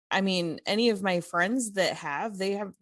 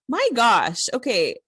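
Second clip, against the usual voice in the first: louder, higher exasperated outburst; 5 semitones higher, 8 dB louder than usual. Retold or imitated. Shopper reacting to employee guarding the formula, exasperated. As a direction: burst out, fed up, then give in.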